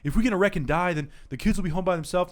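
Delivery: lightly voiced anxious friend; mild mock of a worried friend. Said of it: "Kenny's like".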